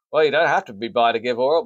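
usual speaking voice

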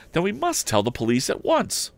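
a keen lad pressing his father; eager young Tom. Acted. Tom questions his father about the theft, high and eager. Tom's voice again.

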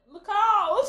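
high loud warning cry broken by laughter; retold shout, laughing. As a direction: yell a warning across the rock. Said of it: "a woman just shouted".

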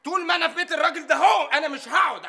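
defiant shout. Acted. Worker refuses to sit, defiant.

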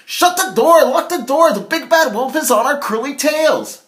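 very high, loud panicked squeal; panicked shout. Acted. Harold flees the wolf to his brother, shouting in panic. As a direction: yell in terror for the door. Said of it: "rushed inside and exclaimed".

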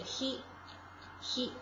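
'he' whispered very slowly and breathily, almost all air; breathy demo of he. Shown as a demonstration. Teacher showing students a slow breathy he. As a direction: breathe out 'he，he' slowly with no voice. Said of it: "中间这个钩子两边可以挂起来".

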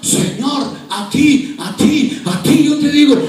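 loud, high, impassioned pulpit delivery; full-force preaching. Real, not acted. Preacher urging the congregation to serve God, impassioned preaching. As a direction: build to a drawn-out, crying-out climax.

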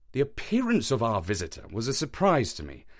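ordinary delivery, nothing marked

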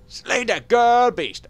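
loud, high, drawn-out command; mock battle cry. Viewer urging the player to attack, hammed-up battle cry. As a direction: stretch it out into a heroic yell.

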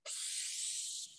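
long hissing s sound; drawn-out s like a snake. Shown as a demonstration. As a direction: hiss the S like a snake. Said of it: "What letter sounds like that?".

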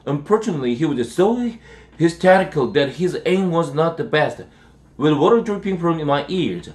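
plain reading voice

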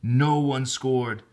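usual speaking voice

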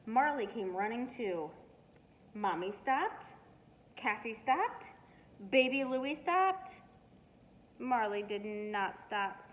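ordinary delivery, nothing marked

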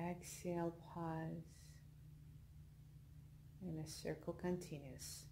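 soft, unhurried meditation voice with long pauses; guided meditation. Acted. Leads a yoga nidra relaxation.

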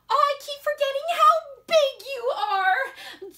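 high, loud motherly apology; gushing mom. Acted. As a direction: coo over your child in a high voice.

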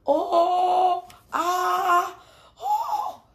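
high, loud panicked cries; high squealing oh ah oh; giraffe shouting. Acted. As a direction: yelp as your legs knot up. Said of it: "shouted Giraffe".

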